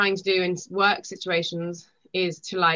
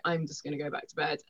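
sleepy friend; 4 semitones lower, 7 dB quieter than usual. Retold or imitated. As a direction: lower and softer, unimpressed. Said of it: "She was like".

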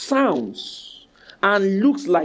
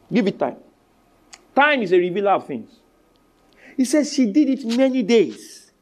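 normal talking voice

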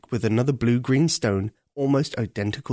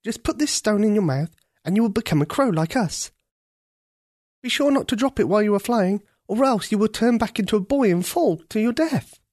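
put-on higher, quick earnest girl's warning; Ketcha instructing; 9 semitones higher than usual. Ketcha warning the prince not to drop the stone. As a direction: hurry through the careful warning.

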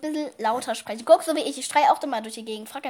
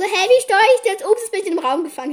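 higher, louder and faster, mock-confused grandma; 7 semitones higher, 8 dB louder, faster than usual. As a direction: play the baffled grandma fumbling with the game. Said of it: "die war so". (verwirrte Oma, höher, lauter und schneller nachgemacht)

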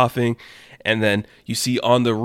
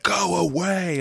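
mock scream; 8 semitones higher, slower than usual. Damien tries to drive the minions off, mock scream. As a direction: play a scream, pitched up at normal volume. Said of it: "he screams at them".

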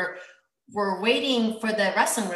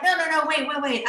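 higher-pitched imitated objection; mock protest; 7 semitones higher, 3 dB louder than usual. Retelling the wrestler wanting a rematch, mock protest. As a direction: imitate a beaten rival calling a halt.

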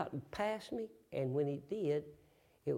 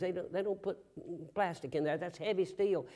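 ordinary talk, nothing marked

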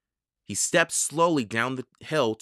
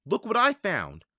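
higher-pitched eager call; 7 semitones higher than usual. A narrator's reading. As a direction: call out to show off a find. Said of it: "Jack called".

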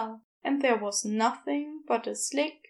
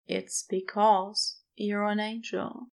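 usual speaking voice

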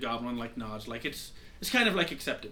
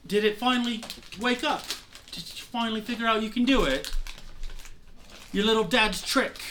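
higher-pitched, lightly acted taunt; needling mentor; 7 semitones higher than usual. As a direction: tease a pupil about finally learning. Mentor needles pupil about a power.

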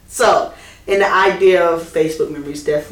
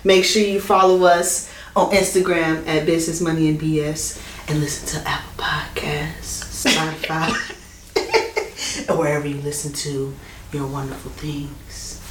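soft, half-whispered late-night radio voice; sexy voice; 4 semitones lower, 5 dB quieter than usual. Pretend. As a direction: purr the plug like a late-night DJ. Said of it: "You like my sexy voice?".

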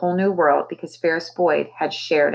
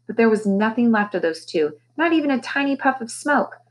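usual speaking voice

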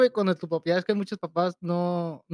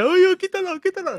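mock scared plea; 12 semitones higher, 5 dB louder than usual. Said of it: "de que".

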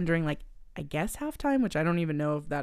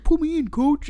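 higher, louder mock plea; pleading player; 9 semitones higher, 8 dB louder than usual. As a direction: beg the coach with hammed-up eagerness. Girl begging coach, voiced.

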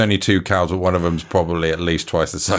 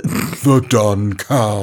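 stretched-out silly drawl; very slow; 3 dB louder, much slower than usual. Pretend. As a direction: draw every word out. Slower drawn-out repeat.

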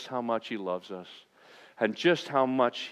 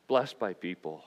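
ordinary delivery

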